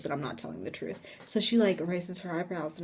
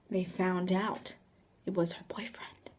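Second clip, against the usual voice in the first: hushed reveal; 9 dB quieter than usual. Retold or imitated. Storyteller revealing the culprit to believing friends, hushed. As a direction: let it sink to a storyteller's whisper.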